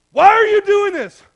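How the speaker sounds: high, loud, slow furious yell. Retold or imitated. Husband yelling at his family in anger, furious shout. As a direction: yell it at them, accusing. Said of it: "You can't yell and then go".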